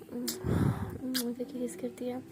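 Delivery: ordinary delivery, nothing marked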